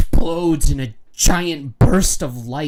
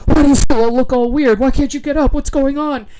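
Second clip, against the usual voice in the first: worried Metamorpho; 11 semitones higher, 5 dB louder than usual. Retold or imitated. Metamorpho voiced, confused at Superman.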